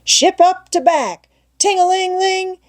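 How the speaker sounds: boy playing captain. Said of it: "giving the orders and executing them".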